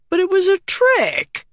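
high-pitched, indignant objection; high, whiny comeback from a cheated crewman; protesting counter. A narrator's reading. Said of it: "countered Lenos".

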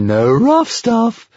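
slow, swooping high singsong. A narrator's reading. Stormfly playfully chides the dragon, singsong. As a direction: sing it out, sliding up high. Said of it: "sang the Stormfly".

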